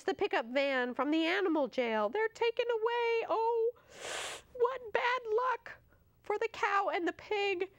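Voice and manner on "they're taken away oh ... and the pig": rising mock-tragic woe, slowing; dramatic dismay. A narrator's reading. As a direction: wail it like a storyteller's lament. Narrator dramatizes misfortune.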